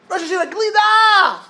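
high, loud cry of "glida!"; pleading shout. Acted. Yeshiva boy shouting his ice cream offer at the sage. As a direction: beg at the top of your voice.

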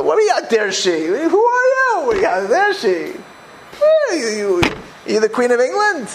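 a haughty lady's high-pitched indignant whine; mock outrage. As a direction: ham up the offended snob's disbelief. Mocks haughty person's outrage.

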